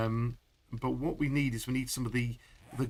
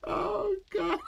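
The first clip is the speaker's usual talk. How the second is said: startled cry. Real, not acted. Shocked by loud glitch noise.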